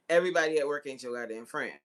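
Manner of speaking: usual speaking voice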